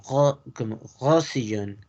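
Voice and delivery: Rosellón with R like a throaty G; French "ghosiyón" for Rosellón. Shown as a demonstration. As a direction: show the G-like R starting Rosellón. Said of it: "la R funge como una G".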